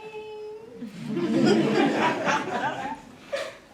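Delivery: long, high laugh; fond laugh. Acted. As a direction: laugh out loud at a fond memory. Fond memory of falling in love.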